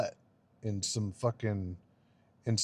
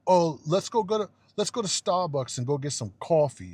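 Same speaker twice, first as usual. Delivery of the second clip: mock European accent; 4 semitones higher than usual. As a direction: mimic a European ordering "cawfee", mockingly. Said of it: "it's not".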